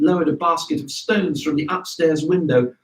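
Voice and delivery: usual speaking voice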